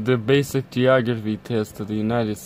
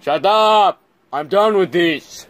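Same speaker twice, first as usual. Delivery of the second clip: loud, high outburst; exasperated shout; 6 semitones higher, 7 dB louder, slower than usual. Pretend. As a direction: yell it out, completely fed up. Fed up with the quiz.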